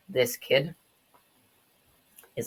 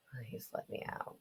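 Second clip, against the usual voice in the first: very soft whisper; faint plea; 3 semitones lower, 19 dB quieter than usual. Acted. Trapped kid begging to be let out, faint whispered plea. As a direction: whisper it as if giving up.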